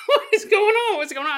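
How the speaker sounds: high, loud mock alarm through a laugh; laughing panic. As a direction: freak out while cracking up. Waking under a bleeding gnome.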